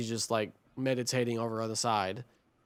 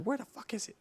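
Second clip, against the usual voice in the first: high but soft mock cussing; pitched-up, held-back imitation of cursing; imitated cussing; 10 semitones higher, 9 dB quieter, faster than usual. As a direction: act out her outburst under your volume. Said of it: "you just see her cussing everybody out".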